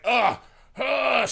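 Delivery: high, loud mock grunts of exertion; exaggerated stabbing noises; effortful grunts. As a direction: grunt as if heaving a pitchfork. Imitates pitchfork thrust.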